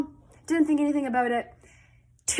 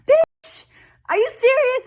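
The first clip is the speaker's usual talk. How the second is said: much higher, loud, half-screamed disbelief; stunned shriek; 13 semitones higher, 8 dB louder than usual. Retold or imitated. Reacting to the agent's booked trip, shrieking disbelief. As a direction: shriek it like you just won something.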